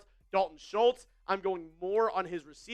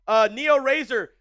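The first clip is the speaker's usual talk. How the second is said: louder, higher hype call to chat; hype streamer; 6 semitones higher, 7 dB louder than usual. Real, not acted. Streamer hyping almost six hundred viewers to shout out cities, excited. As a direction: pump up the viewers.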